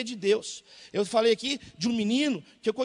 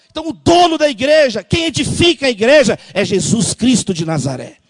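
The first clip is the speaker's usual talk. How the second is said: loud fiery preaching; 13 dB louder than usual. Acted. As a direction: proclaim it loudly to the church. Preaches at full force.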